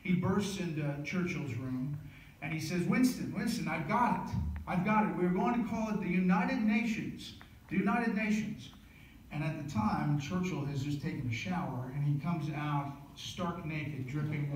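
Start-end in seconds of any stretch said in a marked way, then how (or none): 2.9-8.7 high, loud, thrilled Roosevelt calling out; excited. Retold or imitated. FDR bursting into Churchill's room, excited. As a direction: burst in with the big idea.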